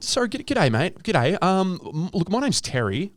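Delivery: high-pitched, hesitant politeness; polite bouncer. Acted. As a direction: nervously introduce yourself to a rowdy drunk. Playing polite staffer ejecting drunk.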